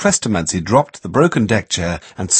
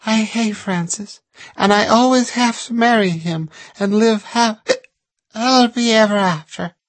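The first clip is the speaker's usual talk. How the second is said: mournful; 14 semitones higher than usual. A narrator's reading. Lucy continues mournfully.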